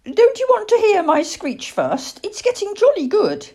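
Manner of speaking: very high, proud little-owl voice; young owl Plop, eager. Acted. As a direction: owlet keen to show off.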